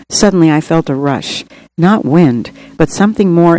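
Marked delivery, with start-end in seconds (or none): none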